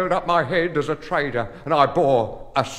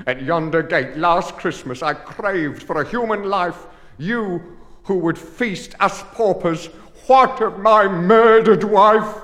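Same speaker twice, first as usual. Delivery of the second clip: furious accusation; 6 semitones higher, 9 dB louder than usual. Acted. Pauper confronts the guardians in fury.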